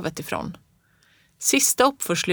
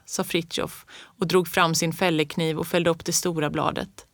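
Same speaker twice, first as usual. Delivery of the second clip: plain reading voice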